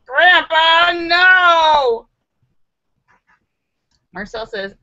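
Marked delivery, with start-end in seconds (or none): 0.1-2.1 high, loud mock outrage; mock dismay. Reacting to grandpa's zoo ban, loud and high. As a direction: cry out at grandpa in shock.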